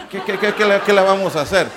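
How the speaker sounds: laughing through the words at his own joke. Real, not acted. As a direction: chuckle as you shrug it off. Joke about wives, laughing.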